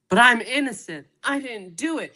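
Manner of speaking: high-pitched imitation of a protesting convict; hammed-up high protest; mock innocent plea. Voicing the convict pleading innocence.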